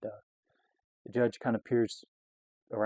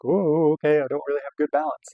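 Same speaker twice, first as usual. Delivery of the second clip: raised, louder mock wobble; wobbly alarm; 5 semitones higher, 9 dB louder than usual. Drunk rider to himself while swerving, wobbly alarm. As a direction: exclaim as if losing your balance.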